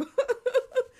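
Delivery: high loud burst of laughter. Real, not acted. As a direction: crack up loudly. Big laugh.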